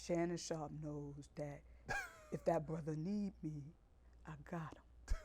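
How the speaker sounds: low, hushed podcast-voice impression; Stephen A. Smith. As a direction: drop into a soft, low podcast voice. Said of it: "since we're doing impressions".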